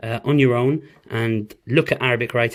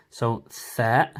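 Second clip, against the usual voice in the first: usual speaking voice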